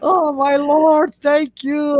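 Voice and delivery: high, slow cry of praise; thankful wailing. Retold or imitated. Family thanks heaven for the child's return, wailing. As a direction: imitate a relieved relative wailing thanks.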